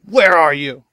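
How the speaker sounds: loud. Pretend. Mocking the game's questions.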